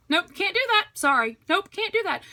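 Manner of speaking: brushing off. Pretend. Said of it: "easy for me ... to say no".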